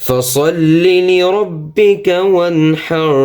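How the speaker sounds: slow, high melodic chant; slow tilawat of the verse; Quran recitation. Shown as a demonstration. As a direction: draw out لربك with a doubled lam.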